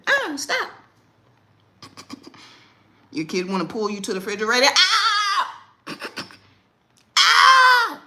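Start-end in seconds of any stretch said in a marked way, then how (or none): 0.1-0.7 high yelp; dramatic. Shown as a demonstration. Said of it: "be dramatic". 4.8-5.6 high loud yelp; dramatic yelp. Shown as a demonstration. Mom dramatically yelping when pulled. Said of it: "be dramatic". 7.2-8.0 high loud yelp; dramatic yelp. Shown as a demonstration. Mom dramatically yelping when pulled. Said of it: "be dramatic".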